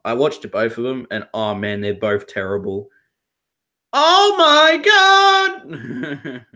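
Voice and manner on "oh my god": high, loud drawn-out cry; over-the-top horrified yell; meme scream. Retold or imitated. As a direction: shout it like a bad-movie meme. Imitates Troll Two meme scream.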